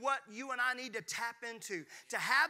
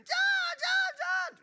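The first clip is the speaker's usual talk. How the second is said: screeching high panicked mom, much louder; bad imitation of mom; 9 dB louder than usual. Pastor imitating his mom screaming for his dad over a mouse. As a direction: shriek his name like a terrified mother. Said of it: "that sounds like a bad imitation".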